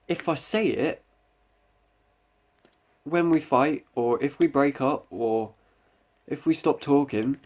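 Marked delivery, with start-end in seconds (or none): none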